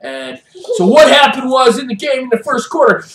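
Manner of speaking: usual speaking voice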